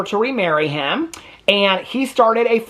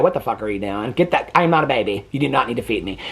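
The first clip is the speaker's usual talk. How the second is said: imitating husband; 7 semitones lower than usual. Husband refusing to be spoon-fed, low deadpan mock grumble. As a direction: drop your voice, unimpressed husband. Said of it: "my husband would look at me and go".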